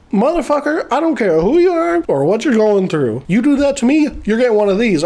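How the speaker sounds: hammed-up indignation; mock telling-off. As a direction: act out a scolding threat. Acted telling-off while hitting him.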